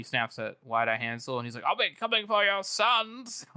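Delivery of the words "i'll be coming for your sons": high, slow, mock-menacing growl of a threat; quoting Logan snapping at the enemy envoy, slow and high. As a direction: drag it out like a hammed-up warning. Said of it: "Logan snaps at the end here".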